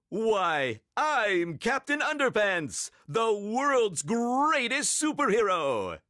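high, drawn-out superhero boast; Captain Underpants. A narrator's reading. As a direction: announce yourself as a pompous hero. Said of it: "said Captain Underpants".

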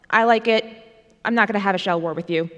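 ordinary talk

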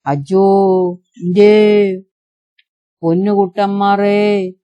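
drawn-out keening cry, slow on the middle word; wailing lament. A narrator's reading. Elders mourning the lost boy, slow keening wail. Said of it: "കൂട്ടക്കരച്ചിൽ പുലവിളിയുടേതാണ്".

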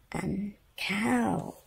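soft whispered words; hushed ending. Acted. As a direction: whisper it gently. Whispered list end.

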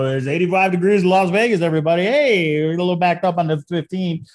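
big booming arena announcer. Pretend. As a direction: hype up the crowd like an announcer. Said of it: "that's my announcer voice".

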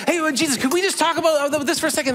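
high, hopeful pleading as Peter; eager Peter. Retold or imitated. Peter begging Jesus for a word, high and eager. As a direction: pitch way up, nervously eager to talk. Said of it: "Peter's like".

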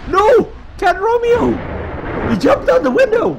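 high, loud yell of protest; alarmed shouting. Real, not acted. Gamer reacting to Romeo jumping out the window, alarmed shout. Cries out at game mishap.

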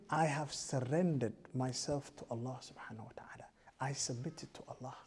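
soft, unvoiced words of surrender; hushed vow of surrender to God; whispered declaration. Voicing a believer's declaration.

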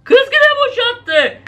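high, slow and fed up; high slow exasperated. Real, not acted. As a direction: groan it out in disbelief. Exasperated comment.

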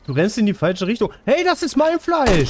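high, loud outraged yelling; indignant shout. Real, not acted. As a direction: yell up high at the thief. Shouts at animal stealing meat.

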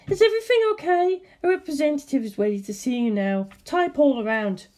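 high, sugary receptionist; a high front-desk voice with an edge; suspicious receptionist. Acted. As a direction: receptionist catching an intruder, high and sweet. Changed receptionist, too cheerful.

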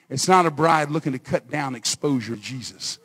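ordinary talk, nothing marked

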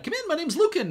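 friendly host voice. Acted.

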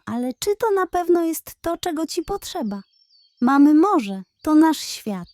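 a touch of the wise elder pressing her point; older seal. Acted. Older seal gently argues.

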